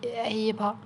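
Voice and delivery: ordinary delivery, nothing marked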